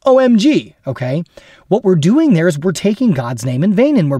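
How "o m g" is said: exasperated. Shown as a demonstration. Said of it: "Or they say".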